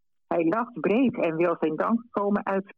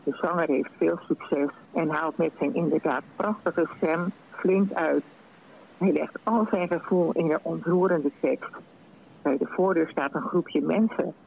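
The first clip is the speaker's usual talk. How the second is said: plain reading voice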